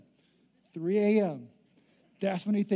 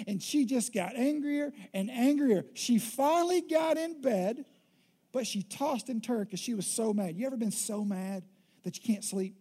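normal talking voice

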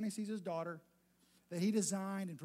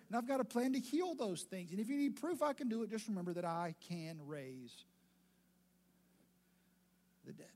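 ordinary talk, nothing marked

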